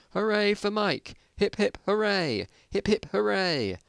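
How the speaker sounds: rally a crowd: hip hip hooray; crowd cheering. Acted. Crowd below the balcony cheering Mike, high and slow.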